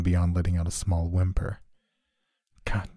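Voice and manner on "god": whispered, soft curse; muttering. Acted. John cursing to himself over her, whispered. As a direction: hiss it under your breath. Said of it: "John was muttering".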